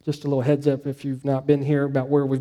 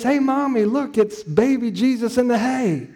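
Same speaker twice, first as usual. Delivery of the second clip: put-on excited little boy; 7 semitones higher than usual. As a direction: sound like a three-year-old thrilled by the nativity. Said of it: "And he says".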